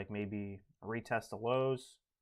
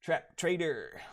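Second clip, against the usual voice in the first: higher and drawn-out slow; RT voice practice; 5 semitones higher, 4 dB louder, slower than usual. Retold or imitated. Practicing RT's voice, slow and higher. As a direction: stretch it out slow in a put-on voice. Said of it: "I gotta practice my R T voice".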